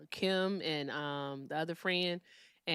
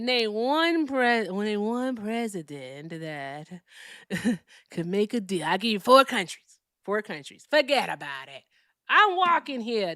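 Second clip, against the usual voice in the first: Brooklyn impersonation; 6 dB louder than usual. Acted. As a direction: do a hammy Brooklyn tough talker. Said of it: "that's my, like, Brooklyn impersonation".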